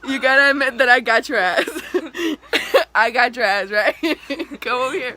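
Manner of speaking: loud triumphant laughing. Real, not acted. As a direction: crow over the prank while laughing. Gloating after prank reveal.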